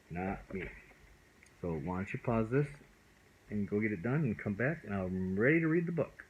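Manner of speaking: ordinary delivery, nothing marked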